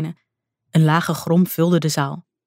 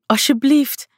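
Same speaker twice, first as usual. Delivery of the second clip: pleading scream; 8 semitones higher, slower than usual. A narrator's reading. Jacinta begging the god to open his eyes, screaming plea. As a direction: beg him at the top of her voice. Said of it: "riep ze smekend, gillend".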